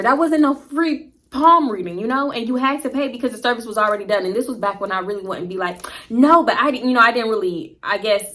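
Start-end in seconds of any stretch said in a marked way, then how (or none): 0.0-2.0 indignant. Real, not acted. Indignant complaint to viewers. 6.1-6.4 a sharp, loud no; firm loud no. Pretend. Imagined refusal.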